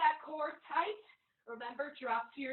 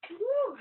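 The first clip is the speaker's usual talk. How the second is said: cheer; 10 semitones higher, 8 dB louder than usual. Real, not acted. Trainer cheering mid-workout.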